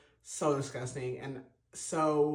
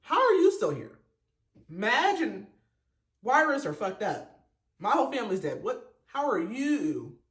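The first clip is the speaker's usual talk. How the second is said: high, loud outraged accusation; indignant quote; 5 semitones higher, 4 dB louder than usual. Retold or imitated. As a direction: hurl it at him in disbelief.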